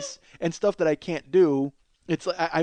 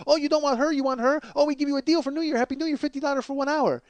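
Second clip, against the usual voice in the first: higher, rattled-off mock sales patter; pushy masseuse impression, pitched up; relentless parlor women; 7 semitones higher than usual. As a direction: hammer the customer with offers, imitating. Imitating relentless parlor women selling.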